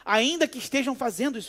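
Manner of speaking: ordinary talk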